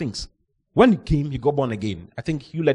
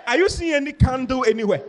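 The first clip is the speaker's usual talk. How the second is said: incredulous; 10 semitones higher than usual. Retold or imitated. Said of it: "So I asked them".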